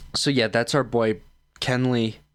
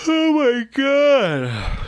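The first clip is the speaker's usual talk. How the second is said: much higher, louder and slow, stretched through a real yawn; 6 dB louder, much slower than usual. Real, not acted. Tired vlogger yawning mid-video, words stretched high and slow. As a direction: let a big yawn drag the words out. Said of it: "Can I stop yawning?".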